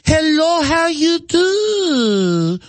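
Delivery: mock someone talking down to a foreigner; condescending greeting.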